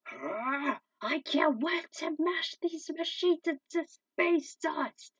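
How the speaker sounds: high, loud, slow gloating monster voice; gleeful villain. Acted. Mutant villain gloats to his comrades, loud and slow. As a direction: relish every word of the threat.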